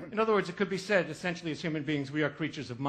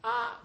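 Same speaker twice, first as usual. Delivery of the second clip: short high yelp, lighter this time; a quick scared squeak; nervous little cry at a bump; frightened yelp; 8 semitones higher than usual. Retold or imitated. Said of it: "I went".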